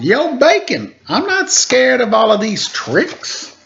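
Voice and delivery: a shaken wolf faking bravado; wolf hollering. Acted. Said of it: "but hollered".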